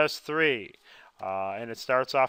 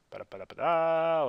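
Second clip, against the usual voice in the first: "ba-da" flourish, pitched up with a held ending; sung-out transition jingle; 4 semitones higher than usual. Real, not acted. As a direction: toss in a little vocal fanfare. Vocal flourish moving to next slide.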